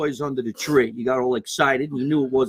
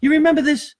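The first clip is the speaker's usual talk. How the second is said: much higher and louder, eager; excited; 11 semitones higher, 6 dB louder than usual. Real, not acted. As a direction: burst out with excitement. Excited reaction to message.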